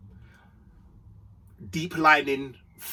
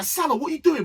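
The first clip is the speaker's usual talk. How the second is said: exasperated call; 11 semitones higher, faster than usual. Retold or imitated. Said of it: "I was like".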